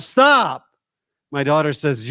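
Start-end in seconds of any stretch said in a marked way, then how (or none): 0.0-0.7 sudden loud, high yell of a fed-up dad; retold yell. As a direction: reenact yelling out at noisy kids. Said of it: "and I yelled out".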